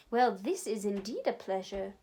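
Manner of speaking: ordinary delivery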